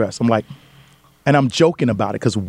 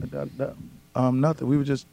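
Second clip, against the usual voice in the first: sheepish mumble; 10 dB quieter than usual. Retold or imitated. Caught guy stammers an excuse.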